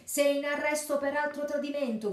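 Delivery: high, loud triumphant yell; sheriff shouting. A narrator's reading. Sheriff shouting the arrest at the captured friar. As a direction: bark out the arrest. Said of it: "gridò lo sceriffo".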